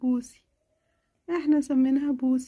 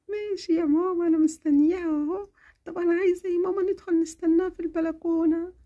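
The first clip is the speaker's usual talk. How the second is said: put-on sick little girl. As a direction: feverish child complaining to mama. Child asks to wait.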